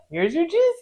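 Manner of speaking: high, chirpy handing over of juice; sweet offer. Retold or imitated. Nurse imitating a coworker handing out juice, high and chirpy. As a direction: cheerfully hand over the cup. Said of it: "just be like".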